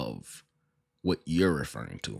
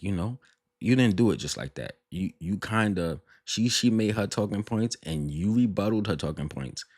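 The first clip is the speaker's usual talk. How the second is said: normal talking voice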